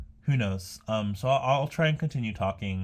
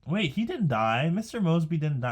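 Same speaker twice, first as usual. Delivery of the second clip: higher and louder, startled realization; sudden confusion; 7 semitones higher, 4 dB louder than usual. Real, not acted. Vlogger realizes the actor didn't die, surprised and confused. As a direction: blurt it out, genuinely thrown. Realizing the news was wrong.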